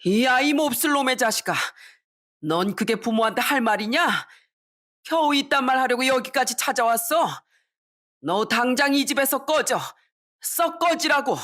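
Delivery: father's high-pitched rage, climbing to the end; furious yelling. Acted. Father throws his son out of the house, furious yelling. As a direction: order him out in a fury. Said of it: "부들부들 떨면서 이렇게 소리치더군요".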